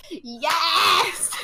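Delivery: high, loud laughing cheer; triumphant yes. Retold or imitated. Told a stranger backed her, a laughing victory cry. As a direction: celebrate the win with a shout. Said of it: "And I was like".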